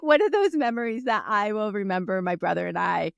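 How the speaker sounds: ordinary talk, nothing marked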